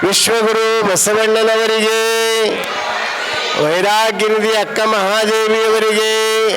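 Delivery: slow, solemn salute to the saints; closing invocation. Real, not acted. Preacher closing his talk to devotees, solemn invocation. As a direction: intone the honorifics like a closing benediction.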